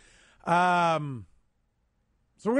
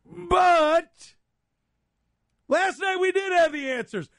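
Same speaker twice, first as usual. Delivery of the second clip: sincere shout; 13 semitones higher, 4 dB louder, slower than usual. Real, not acted. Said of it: "there's no sarcasm".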